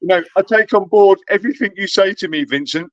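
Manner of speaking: mock fawning Grumpy. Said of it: "he's Grumpy going".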